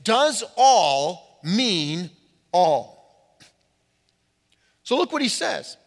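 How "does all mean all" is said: slow, weighted does all mean all; slow stress on all. Shown as a demonstration. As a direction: lean hard on each all, dragging it out. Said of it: "The question we say".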